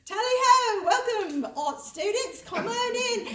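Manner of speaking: playful fake British accent. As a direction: welcome the class like a jolly Brit. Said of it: "I would go into my British accent".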